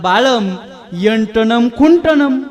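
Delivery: slow, loud, stretched pseudo-Sanskrit chant; mock Sanskrit. Sanskrit-crazed father answers son on the phone, slow mock Sanskrit. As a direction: draw out every -am like a solemn recitation. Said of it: "ते तर संस्कृतला येडं झालेलं".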